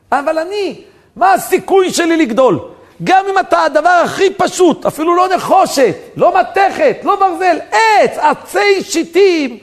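high, loud, fervent sermon shouting; preaching at full force. Acted. Preacher exhorting listeners not to despair, loud fervent shouting. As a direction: cry it out to the congregation. Preacher cries out his message.

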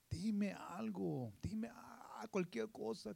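soft breathy pleading whisper, slow; whispered plea. Acted. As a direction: beg God under your breath. Pleading prayer in sermon. (susurro suplicante)

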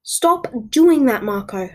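put-on loud, high, drawn-out scolding of a boyfriend; screaming. A narrator's reading. Julia telling Marco to stop, screaming. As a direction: cry out in protest, then drop on the name. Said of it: "I screamed at the top of my lungs".

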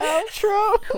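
high announcer-style outro; outro call. Acted. Cohost calling the outro, high announcer voice. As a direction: sing out the word like a jingle.

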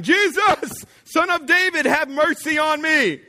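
high, loud cry for mercy; shouting. Acted. Blind beggar calls to Jesus passing by, shouting. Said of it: "So he began shouting".

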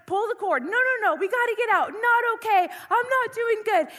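high, loud reenacted panic; freaking out. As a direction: plead urgently to get out, panicking. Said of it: "I really start to freak out".

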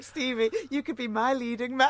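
high mock-smitten voice with laughter; hammed-up lovestruck fan; teasing mock gushing. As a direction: tease with a high, laughing swoon. Co-host teasingly acts out gushing.

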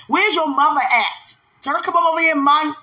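high, loud retold summons; impatient calling out. Woman retelling how she demanded someone come, mock impatient. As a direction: call over as if fed up waiting. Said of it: "You know, I was like that".

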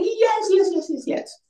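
high-pitched string of yeses, falling as it goes; excited acceptance. Retold or imitated. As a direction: replay saying yes to a proposal. Acts accepting the proposal.

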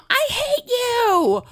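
high-pitched, drawn-out mock kid scream; furious kid's yell. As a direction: yell it like a raging child in the back seat. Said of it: "then Odie is like".

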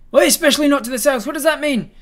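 high, loud, shocked questioning; alarmed demand. Acted. Adventurer startled by the guard's warning, high and loud. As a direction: demand to know what he means.

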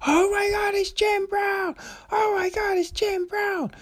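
put-on very high, squealing starstruck girl. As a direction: squeal it like a young fan meeting her hero. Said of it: "And she's like".

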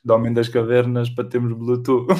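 loud laughing delivery. Real, not acted. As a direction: push the punchline through laughter. Punchline of the shared joke.

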